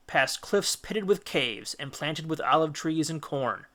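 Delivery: ordinary delivery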